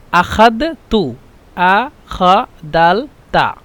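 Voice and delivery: আখাদ তু slowly, with দ in place of জ; Egyptian আখাদ তু. Shown as a demonstration. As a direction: spell out আ খা দাল তা syllable by syllable, slowly. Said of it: "এরা বলে". (মিশরীয় উচ্চারণে আখাদ তু, ধীরে ধীরে)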